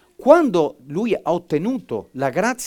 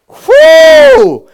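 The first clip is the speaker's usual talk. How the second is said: sudden high shout of joy; ecstatic cry; 19 dB louder than usual. Real, not acted. Preacher whooping in ecstasy at the climax. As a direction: burst out with a loud high whoop.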